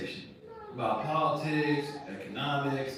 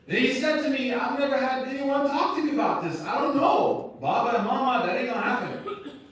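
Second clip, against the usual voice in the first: high and loud, in full preacher force; a sermon climax, loud and pitched up; fiery preaching; 10 semitones higher, 7 dB louder than usual. Real, not acted. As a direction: drive it home to the crowd.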